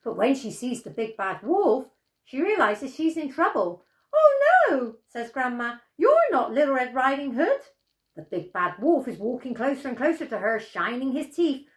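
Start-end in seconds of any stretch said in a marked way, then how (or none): 4.1-5.0 high, loud cry of alarm; a shrill panicked old lady; frightened grandma. Acted. Grandma sees the wolf coming, high frightened cry. 6.0-7.6 alarmed grandma. Acted. Grandma sees the wolf.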